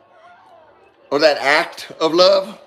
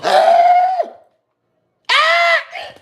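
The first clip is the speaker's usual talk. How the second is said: delighted squeals. Retold or imitated. Wife opening the ring box, squealing with delight. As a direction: squeal like she just opened the gift. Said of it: "and she goes".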